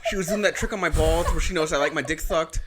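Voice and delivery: ordinary talk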